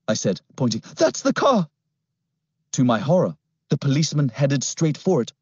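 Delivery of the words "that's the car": put-on high, alarmed boy's cry; urgent boy. A narrator's reading. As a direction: point it out fast. Boy spots car.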